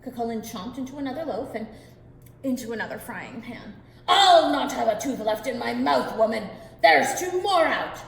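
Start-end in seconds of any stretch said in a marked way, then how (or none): 4.1-8.0 loud pained giant holler. Acted. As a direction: holler about lost teeth. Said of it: "Cucullin hollered even louder".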